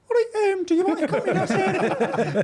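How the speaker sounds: very high, squeaky mock voice; mimicking Sean. Retelling the friend's text invitation, very high mock voice. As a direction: pitch it way up like the friend. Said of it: "Sean Allison texts me, goes".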